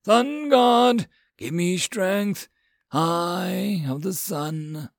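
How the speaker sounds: invoke a god with stretched words; crying out an invocation. A narrator's reading. Hero cries out to his god.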